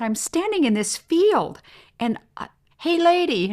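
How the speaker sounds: high, loud call across a field, hey, lady; hammed-up city visitor hailing a farmer; excited tourist calling out. Tourist shouting from the soybean field, excited and high. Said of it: "And she said".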